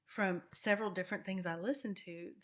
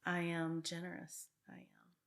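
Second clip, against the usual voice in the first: affirmation demo; 3 dB louder than usual. Shown as a demonstration. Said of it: "So I say things like".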